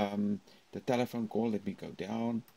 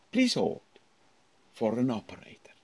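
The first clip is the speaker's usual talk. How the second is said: phone announcement. Shown as a demonstration. Teacher demonstrating the hold message. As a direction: announce it like a recorded line. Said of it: "There is a nice silence here".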